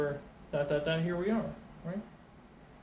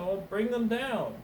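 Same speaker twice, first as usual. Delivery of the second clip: higher, louder and slower call; calling down to helper; 8 semitones higher, 5 dB louder than usual. Acted. Cook calls to the helpers on the hill, higher, louder, slower. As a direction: call them down the slope with a grin. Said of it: "Somebody said".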